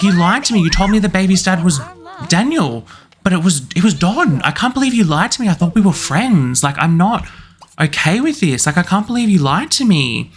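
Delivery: high-pitched, wounded protest; acted betrayal. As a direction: accuse your friend, voice swooping up. Acted quarrel, friend feels betrayed.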